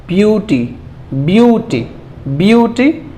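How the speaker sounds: "ब्यूटी" with a long drawn "byoo", then "tee"; slow, separated reading of "ब्यूटी"; sounding out syllables. Shown as a demonstration. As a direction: stretch the first part of "ब्यूटी". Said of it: "जिस हिस्से को आप पढ़ते जाएं".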